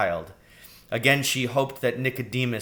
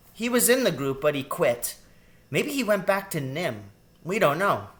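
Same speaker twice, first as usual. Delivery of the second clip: put-on high child's voice; piping young rat; girl rat explaining; 7 semitones higher than usual. A narrator's reading. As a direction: tell it like a youngster in the know. Child rat tells of Jenner.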